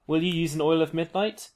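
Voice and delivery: usual speaking voice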